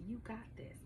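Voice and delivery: breathy private whisper of reassurance; whispered self encouragement. Retold or imitated. Whispering courage to herself before answering, hushed. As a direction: whisper it to yourself. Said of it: "I'm really telling myself".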